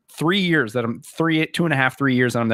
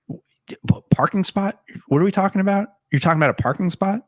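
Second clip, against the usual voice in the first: high, fast, incredulous questions; mock baffled disbelief; 7 semitones higher, faster than usual. Coach mocking him, high incredulous questions.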